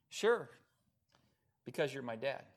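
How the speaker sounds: ordinary delivery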